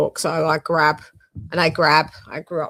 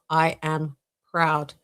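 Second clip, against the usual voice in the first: ordinary talk, nothing marked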